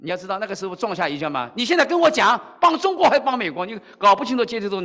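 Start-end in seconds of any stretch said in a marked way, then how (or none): 1.6-4.8 high, heated challenge; angry demand. Retold or imitated. Old veteran interrogating the pastor, heated and high. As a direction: press him hard with the question.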